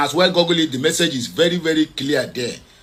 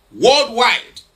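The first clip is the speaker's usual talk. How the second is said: Worldwide punched higher and louder; stress on WORLDWIDE; 5 semitones higher, 5 dB louder than usual. Real, not acted. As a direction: hit Worldwide hard as one emphatic word. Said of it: "emphasis on worldwide".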